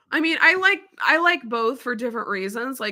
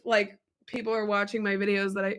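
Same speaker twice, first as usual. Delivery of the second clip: ordinary delivery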